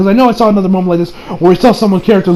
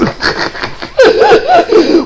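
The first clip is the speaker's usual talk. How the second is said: burst of laughter. Real, not acted. Laughing at his own obsession.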